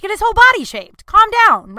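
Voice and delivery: high, loud reenacted outburst; retold indignant outburst. Crazy cat lady tells groomers about her best friend, indignant. Retells snapping at pet groomer.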